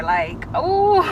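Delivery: high choked laugh; tearful laugh. Real, not acted. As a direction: laugh to hold back tears. Emotional laugh, near tears.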